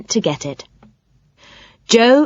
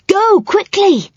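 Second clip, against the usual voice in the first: high, slow urgent voice; urgent warning; 13 semitones higher, slower than usual. A narrator's reading. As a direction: hurry them away in a high voice. Miss Muffet warns the others.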